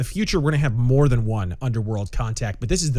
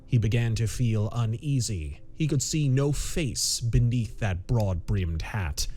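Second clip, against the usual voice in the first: story narration; 5 semitones lower than usual. A narrator's reading. Narrator unfolding a tense pulp mystery, measured story narration. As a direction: read it deliberately, with suspense between phrases.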